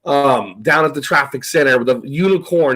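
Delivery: ordinary talk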